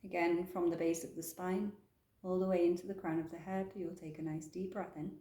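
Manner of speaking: soft, calm meditation guidance; guided meditation. Acted. Yoga teacher guiding the class into stillness, soft meditation voice. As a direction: ease them gently into the breath.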